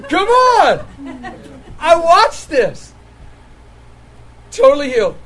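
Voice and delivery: high, loud cry of wonder; excited shout. Real, not acted. Preacher exclaiming to the crowd, loud and thrilled. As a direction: shout it out, amazed.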